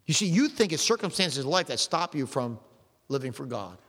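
ordinary delivery

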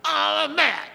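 high, strained imitation of a fit; mock tantrum. Preacher acting out someone mad at an object, mock tantrum. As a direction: act out kicking things in a temper. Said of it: "They break things. They kick".

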